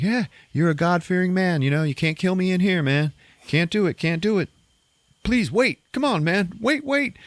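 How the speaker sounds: high-pitched panicky pleading; begging. Retold or imitated. Wounded villain begging the gunman in church, high panicked pleading. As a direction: plead for your life, voice climbing. Bogue crawling, begging for life.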